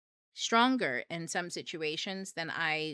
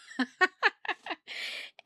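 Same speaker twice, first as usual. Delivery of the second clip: high burst of laughter; 13 semitones higher, 5 dB louder than usual. Real, not acted. Laughing that husband sent an anime edit. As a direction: laugh in disbelief.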